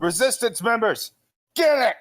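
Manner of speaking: high shouted rallying call; rally the troops into the charge; battle cry. Acted. Calling his troops to attack.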